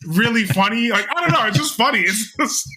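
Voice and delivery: giggly, high-pitched delight; laughing. Real, not acted. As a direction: laugh through the words. Laughing while praising the scene.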